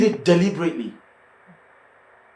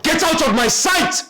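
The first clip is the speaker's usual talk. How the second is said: furious shout; 8 dB louder, slower than usual. Acted. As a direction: scream it high and loud, dragging out sight. Wife throws tenant out.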